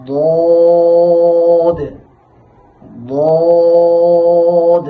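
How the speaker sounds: loud sung Sad, held steady; Arabic letter demo. Shown as a demonstration. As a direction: chant the letter out loud like Quran recitation. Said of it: "তার মাখরাজটা আমরা জেনে নিই". (জোরে টেনে সদ উচ্চারণ)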